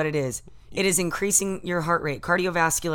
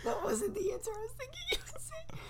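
whispered aside; 9 dB quieter than usual. Real, not acted. Disappointed aside.

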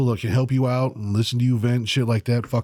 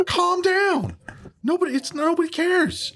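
mocking; slower than usual. Teasing his friend.

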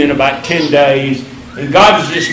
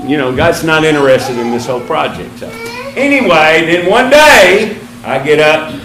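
usual speaking voice

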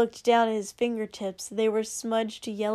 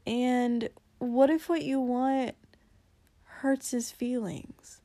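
gentle; 2 semitones higher, slower than usual. Acted. Gentle careful question.

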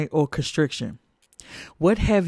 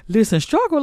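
higher fired-up call; preacher's exclamation; 9 semitones higher than usual. Acted. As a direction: call it out to the congregation.

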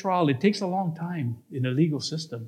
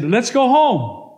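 slow, loud, raised-pitch order; insistent demand; 6 semitones higher, 6 dB louder, slower than usual. Acted. Man refused by his girlfriend, slow loud demand. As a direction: press each word, higher and louder.

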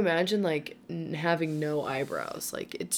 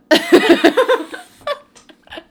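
loud laugh; 13 dB louder than usual. Real, not acted. Sister joke, big laugh.

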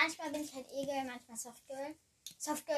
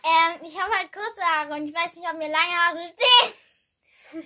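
much louder, almost sung, slow ending; to someone hard of hearing; 4 semitones higher, 13 dB louder than usual. Shown as a demonstration. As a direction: repeat it loudly so everyone hears. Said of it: "soll ich schreien?".

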